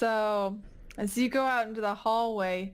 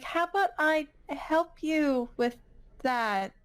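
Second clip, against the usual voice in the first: higher-pitched, hesitant offer; tactful, wary nurse; 6 semitones higher than usual. Acted. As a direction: offer help carefully in a raised pitch.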